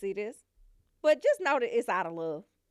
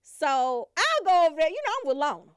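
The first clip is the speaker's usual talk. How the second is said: ordinary delivery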